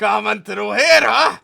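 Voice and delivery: loud, high mocking Mexican accent; put-on Mexican accent. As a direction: jeer at the rider in a hammed-up Mexican accent. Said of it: "I can't do the accent".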